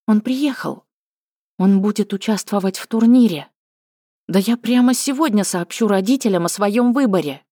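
thrilled, rushing to decide; excited. Acted. Sees her beloved arrive.

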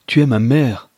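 stunned shout; crying out. Acted. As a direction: cry it out at your mother in shock. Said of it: "s'écria-t-il".

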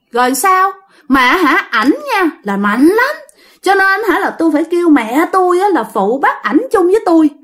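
put-on high, simpering girlish voice; coy woman spirit. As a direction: coo it like a lovestruck ghost girl. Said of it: "nói giọng đàn bà luôn".

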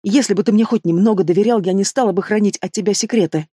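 ordinary delivery

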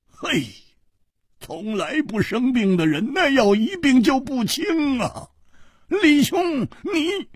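high, urgent worried pleading; a friend sweating with fear; hoarse with worry. Acted. Hu Tiehua urges Li to help sick Chu, anxious. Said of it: "他哑着声音说".

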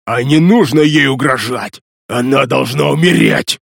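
growling crazed undead; Tobard's growling voice. Acted. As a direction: snarl it at him. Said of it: "зарычал я на него голосом Тобарта".

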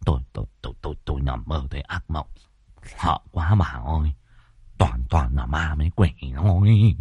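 put-on low, subdued old husband shaken by a nightmare; frightened stammer. Husband shaken after nightmare, stuttering.

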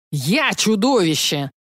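slow, roaring dragon. A narrator's reading. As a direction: roar it out in annoyance. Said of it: "взревел дракон".

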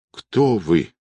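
faintly moaned question; understated groan of a question; moaning. A narrator's reading. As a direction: ask weakly, in pain. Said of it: "простонала она".

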